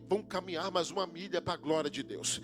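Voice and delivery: steady, earnest closing prayer. Acted. Preacher praying a blessing over the congregation. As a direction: pray over the listeners.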